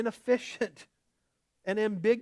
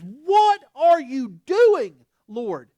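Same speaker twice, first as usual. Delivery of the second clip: much louder, very slow anguished ask; pleading question to God; 7 semitones higher, 13 dB louder, much slower than usual. Acted. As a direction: drag it out in desperate confusion. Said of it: "And our question is".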